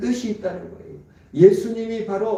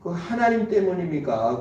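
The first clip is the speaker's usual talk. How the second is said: normal talking voice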